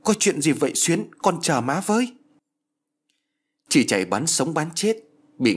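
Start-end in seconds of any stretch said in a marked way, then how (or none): 0.0-2.1 high-pitched pleading cry; frightened, anguished call. A narrator's reading. Mother runs after her groaning daughter, frightened anguished call. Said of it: "vừa kêu trong nỗi sợ hãi khổ đau".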